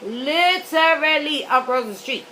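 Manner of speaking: "literally" drawn out slowly, syllable by syllable; stress on literally. Real, not acted. Telling how near the McDonald's was, "literally" slow and emphatic.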